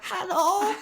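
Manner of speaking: high, put-on phone voice; hammed-up high-voiced character; Tina. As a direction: answer the phone in a silly falsetto. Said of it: "It's Tina".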